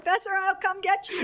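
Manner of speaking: high, pleading Peter Lorre imitation; Peter Lorre as Conseil. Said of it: "can we do a Peter Lorre impression?".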